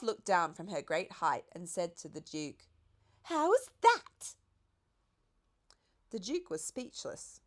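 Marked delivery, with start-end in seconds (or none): 3.2-4.4 much higher, light giraffe voice. A narrator's reading. The giraffe reminds the Duke of his invitation in a high voice. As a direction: play the lofty giraffe.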